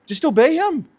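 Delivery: high pleading urge; high emphatic plea. Real, not acted.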